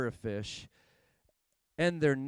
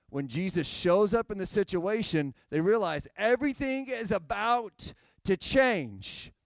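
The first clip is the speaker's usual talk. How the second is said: louder, driving sermon build; preaching; 5 semitones higher, 5 dB louder than usual. Real, not acted. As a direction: press the point home to the congregation. Preaching climax.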